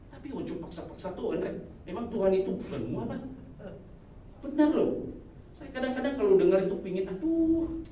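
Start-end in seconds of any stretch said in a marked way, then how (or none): none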